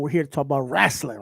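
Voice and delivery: ordinary talk, nothing marked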